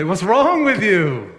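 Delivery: loud, high, drawn-out disbelief; exasperated outrage. Acted. Preacher confronting someone unbothered by a cheating spouse, exasperated outrage. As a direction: confront them like you could shake them. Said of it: "maybe even wanna shake you".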